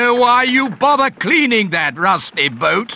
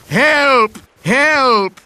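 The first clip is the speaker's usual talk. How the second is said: cry for help; 6 semitones higher than usual. Shown as a demonstration. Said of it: "I can teach Polly what to say".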